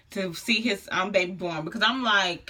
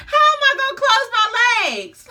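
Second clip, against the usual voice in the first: high, loud exasperated question; hammed-up disbelief, voice shooting up; incredulous; 12 semitones higher, 6 dB louder than usual. Retold disbelief at nurse's order.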